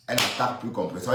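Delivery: normal talking voice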